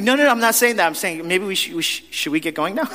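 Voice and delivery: mock-defensive backpedaling; defensive protest. Protesting he's not saying that.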